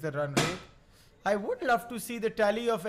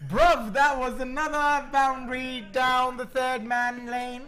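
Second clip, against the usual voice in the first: high, louder roadman voice; London roadman accent; 6 semitones higher, 4 dB louder than usual. Retold or imitated. Roadman slang on commentary.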